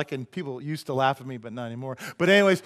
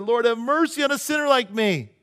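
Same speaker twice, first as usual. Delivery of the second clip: louder, higher mock plea; pleading sinner; 8 semitones higher, 8 dB louder than usual. Tax collector beating his chest in prayer, acted pleading. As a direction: cry out for mercy, pitched up. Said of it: "He beats his chest and said".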